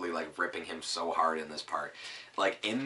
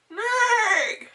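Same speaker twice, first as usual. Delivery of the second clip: very high, loud whinnying "Nay!"; loud high horse whinny; horse neigh; 11 dB louder than usual. Acted.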